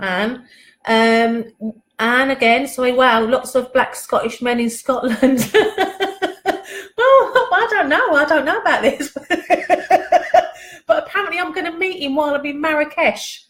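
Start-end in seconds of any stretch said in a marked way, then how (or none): 5.6-13.4 giggling through the words, pitch jumping higher; can't stop laughing at the idea; real bubbling laughter. Real, not acted. Reacting to Anne's joke.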